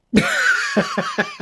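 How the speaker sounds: sudden high-pitched laugh; real amused laugh; high burst of laughter. Real, not acted. Laughing at friend's team.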